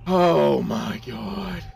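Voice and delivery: slow, high, drawn-out cry of dismay; exasperated groan. Real, not acted. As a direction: stretch it out in loud disbelief. Waylaid in game.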